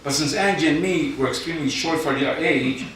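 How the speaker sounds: deadpan spoken-word poem read to a crowd; poem recital. Acted. Poet reading his contest entry to the judges, mock-solemn recital.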